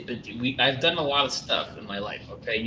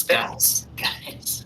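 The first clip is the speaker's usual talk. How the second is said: hushed urgent whisper; breathy unvoiced call. NPC whispers on arrival.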